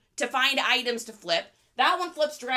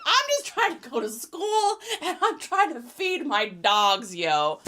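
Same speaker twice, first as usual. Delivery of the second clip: laughing quote; 4 dB louder than usual. Retold or imitated. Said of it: "Creekside's like".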